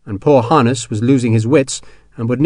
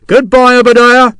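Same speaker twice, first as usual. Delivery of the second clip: much higher and louder, calling down; mocking call; 12 semitones higher, 9 dB louder than usual. Sharp calling down to Hexwell below in the straw. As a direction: taunt him from above with a loud farewell. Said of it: "Sharp called".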